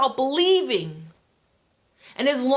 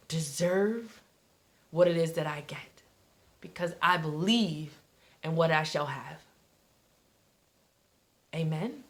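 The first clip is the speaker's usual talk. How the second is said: much softer, lower, prayerful; hushed prayer; 7 semitones lower, 7 dB quieter than usual. Acted.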